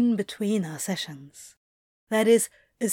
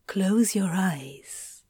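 usual speaking voice

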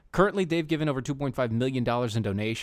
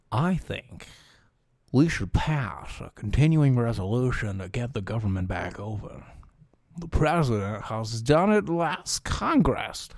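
mock Gardner voice; 3 semitones lower than usual. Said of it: "That's probably nothing like what he sounds".

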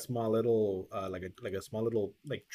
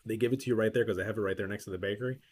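normal talking voice

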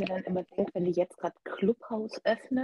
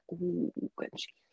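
whispered; 3 semitones lower, 11 dB quieter than usual. Real, not acted. Muttered aside.

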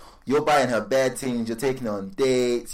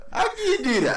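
laughing; 13 semitones higher than usual. Real, not acted. Laughing while ranting.

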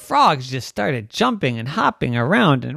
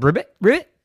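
louder rising ribbits; neighbor croaks; 6 dB louder, faster than usual. Acted. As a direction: call the frogs like one of them. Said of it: "the neighbor said".

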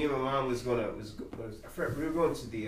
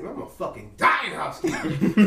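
laughing despair; 6 semitones higher, 6 dB louder than usual. Retold or imitated. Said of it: "I was like".

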